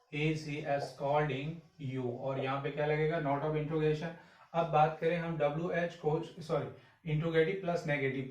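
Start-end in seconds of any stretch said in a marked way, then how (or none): 0.1-2.2 slow dictation. Shown as a demonstration. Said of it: "teacher dictates the sentence".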